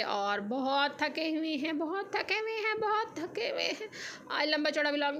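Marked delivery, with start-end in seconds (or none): 1.8-3.9 higher, softer whiny half-crying complaint; mock tired whimper. Shown as a demonstration. Tired vlogger whines to viewers about the exhausting market trip, mock crying. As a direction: whimper it like a worn-out child. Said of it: "हम थक हार के अभी वापस आए हैं".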